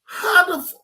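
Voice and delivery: sudden high, loud cry of disbelief; shocked outburst. Real, not acted. Reacting to the university's statement, a shocked high outburst. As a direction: blurt it out, stunned.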